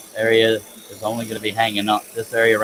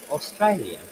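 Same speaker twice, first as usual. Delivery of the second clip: Australian in a put-on British accent; 5 semitones higher, 3 dB quieter than usual. Shown as a demonstration. Woodworker demonstrates British for a listener, British accent. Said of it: "a British person would say".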